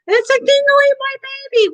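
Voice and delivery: high, put-on dramatic quote voice; movie quote. Quoting a famous movie line, a high hammed-up voice.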